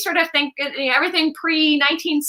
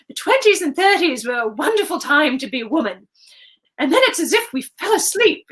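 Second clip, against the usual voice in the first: half-whispered imitation of her grandmother's British accent; lightly imitated posh British accent; 3 semitones higher than usual. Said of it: "she would say it in her accent".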